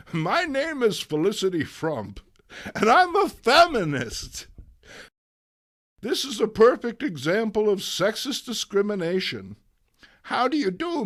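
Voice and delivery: high, loud, strident activist; high declaiming feminist. Acted. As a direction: proclaim it like a crusading speaker. Feminist character introduces herself.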